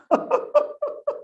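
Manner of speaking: high-pitched mock sobs; put-on crying, high and staccato; imitated sobbing. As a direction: imitate someone bursting into sobs. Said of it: "Like that, he started sobbing, crying".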